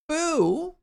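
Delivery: high, drawn-out mock boo; hammed-up high "boo"; playful booing. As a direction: boo them in pretend outrage. Boos at chat in mock outrage.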